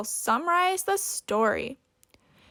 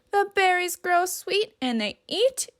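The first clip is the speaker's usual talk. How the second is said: plain reading voice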